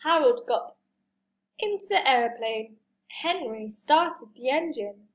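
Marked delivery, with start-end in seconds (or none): none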